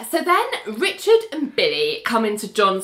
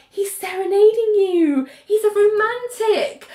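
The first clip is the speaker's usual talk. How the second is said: mock dreamy Elaine; 10 semitones higher than usual. Elaine admiring the serenade, high mock swoon. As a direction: coo it like a lovestruck fan. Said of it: "And Elaine's like".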